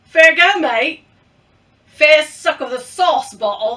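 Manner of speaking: loud, hammed-up Australian; attempted Australian accent. Shown as a demonstration. Vlogger trying out looked-up phrases, shaky attempt at Australian accent. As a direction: have a bad go at an Aussie accent. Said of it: "See, I can't do it".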